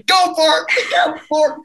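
imitating dad cheering the driver onward; egging on. Dad urging the driver on while crap flies out, laughing. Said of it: "My dad'd say".

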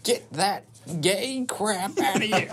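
slow, high hammed-up casting out; mock southern exorcist. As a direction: drive the demon out in a drawn-out southern voice. Acted southern church exorcism.

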